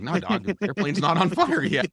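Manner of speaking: mock exasperation at the pestering fire chief; exasperated. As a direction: roll your eyes and shoot it back. Annoyed reply to hourly check.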